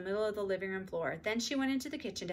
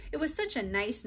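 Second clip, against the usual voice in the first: plain reading voice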